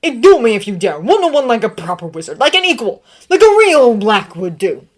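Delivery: loud furious challenge; angry shouting challenge. A narrator's reading. Severus shouting a duel challenge at Sirius, loud. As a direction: hurl the dare at him. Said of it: "he panted".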